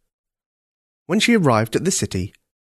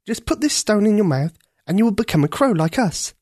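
put-on high, earnest young girl; a girl pleading to save the prince; 9 semitones higher than usual. A narrator's reading. Ketcha voiced in story.

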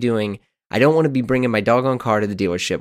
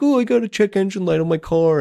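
whiny mock complaint; 9 semitones higher than usual.

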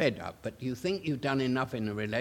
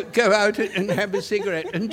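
usual speaking voice

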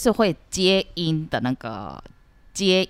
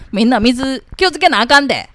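louder, higher Kansai uncle scolding everyone; 6 semitones higher, 7 dB louder, faster than usual. Acted. Kansai uncle warning neighbours to save water, bossy Kansai dialect. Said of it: "おじさん関西弁".

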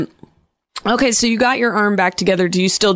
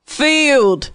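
put-on laughing through a higher, jokey Australian accent; mock Australian accent; 6 semitones higher, slower than usual. Host giggling while coaching a ballplayer in a jokey Australian accent. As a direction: ham up an Aussie baseball tip. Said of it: "That's a good accent".

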